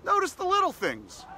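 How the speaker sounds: emphatic call-out. Shown as a demonstration. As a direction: push it out to the audience like a coach. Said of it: "So join me and let's say".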